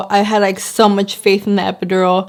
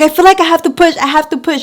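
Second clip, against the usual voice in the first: pained plea; 8 semitones higher, 3 dB louder, faster than usual. Retold or imitated. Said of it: "I wasn't, like, screaming that, but".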